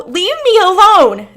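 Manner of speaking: very high, very loud scream. Acted. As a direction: scream it in terror. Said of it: "Tyler screams".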